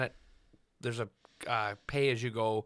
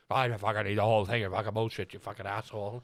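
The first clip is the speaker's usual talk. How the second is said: imitated slurred, lower-pitched swearing; Suede, garbled ranting; 4 semitones lower than usual. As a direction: garble the boast like a sweary drunk. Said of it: "can you translate what you just said?".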